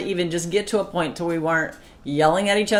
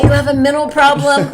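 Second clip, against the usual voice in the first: loud, high, scornful yell; a wife shouting at her husband in an old fight; shouted insult; 9 semitones higher, 9 dB louder than usual. Retold or imitated. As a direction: hurl the question at him in contempt. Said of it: "I used to say that to him".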